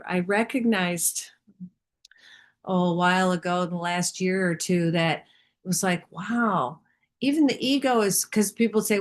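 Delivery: ordinary talk, nothing marked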